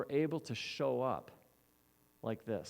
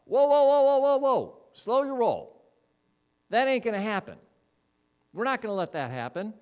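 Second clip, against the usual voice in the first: loud, high alarmed whoa, holding him back; alarmed shout; 9 semitones higher, 10 dB louder than usual. Acted. Peter shouting at Jesus to stop him.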